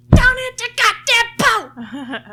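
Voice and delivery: high, very loud, drawn-out holler; exasperated mock shriek; frantic yelling. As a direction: reenact screaming directions at a driver about to crash. Retells yelling directions at driver.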